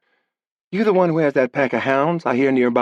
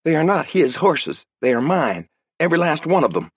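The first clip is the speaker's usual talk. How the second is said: put-on higher, heated young woman; girl furious at Dorfman; indignant girl; 8 semitones higher than usual. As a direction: defend her horses hotly. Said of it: "Her eyes flashed".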